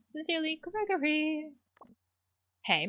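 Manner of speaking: ordinary delivery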